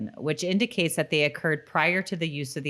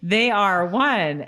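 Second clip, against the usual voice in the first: ordinary talk, nothing marked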